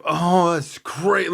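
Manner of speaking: slow, drawn-out mock awe; tripping wonder. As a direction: play it blissfully blown away. Acting out own tripping reaction.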